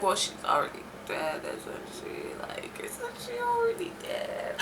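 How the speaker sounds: hushed, whispered retold doubt; barely audible, puzzled whisper; whispered aside. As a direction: whisper it as a confused objection. Retelling her doubt about the ghost's threat.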